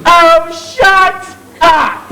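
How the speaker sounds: slow, exasperated snap; snapping. Acted. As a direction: shut the heckler down. Chairman snaps at heckler.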